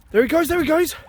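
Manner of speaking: high, eager cheer; excited. Real, not acted. Angler watching the released pike, high and eager. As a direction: watch it swim free with glee.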